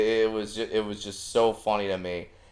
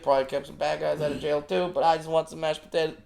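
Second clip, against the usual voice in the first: tired dad voice; 6 semitones higher than usual. Retold or imitated. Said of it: "I had a long day at work".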